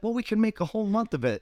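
a light mock of a teasing friend; sarcastic friend. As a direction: egg him on sarcastically. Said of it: "It's like".

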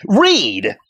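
high, loud one-word call to read; mock preacher shouting read; preacher's yell. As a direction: bark the command like a pastor from the pulpit. Imitating preacher calling for scripture.

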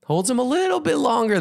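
high, sustained storytelling pitch; suspenseful high narration. A narrator's reading. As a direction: hold the pitch high as the dunk lasts. Narrator draws out the dunking.

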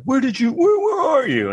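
very high panicked mock voice; shrieking mock panic, pitched high; panicked girlfriend. Girlfriend texting in high mock panic. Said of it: "texted me, like".

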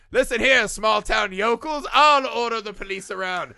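hammed-up bossy doctor; mock Loomis bossing. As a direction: lord it over the small-town yokels. Loomis ordering townsfolk.

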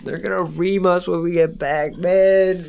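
high, loud whiny complaint; whiny alien grunt. Pretend. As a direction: moan like a doomed underling. Alien crew dreading punishment.

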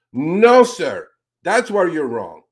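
loud, firm rebuttal with a slow finish; emphatic no. Real, not acted. Host rebuts senator on air, loud and firm. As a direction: shut the argument down hard.

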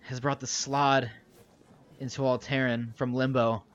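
lightly voiced, portentous old sorcerer; grave old sorcerer. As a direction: deliver the warning in measured pieces with weighty pauses. Game master plays sorcerer Cassius.

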